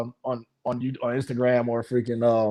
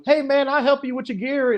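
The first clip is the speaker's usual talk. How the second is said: friendly stranger voice; 11 semitones higher than usual. Retold or imitated. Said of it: "I heard some voice go".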